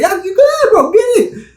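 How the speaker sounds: high, loud taunting cop impression; a hammed-up cop daring him to reach; mocking cop voice. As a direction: bait him like a trigger-happy cop. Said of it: "Y'all quick to be like".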